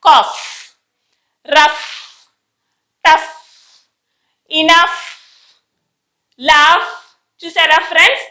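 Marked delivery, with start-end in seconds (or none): 0.1-6.9 కాఫ్, రఫ్, టఫ్, ఇనఫ్, లాఫ్, each ending in "fff"; కాఫ్ to లాఫ్ as spaced demo words with hissed final f; final F sound. Shown as a demonstration. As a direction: fire off each word alone, long gaps between, strong closing f. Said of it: "G పక్కన H ఉంటే ఎఫ్".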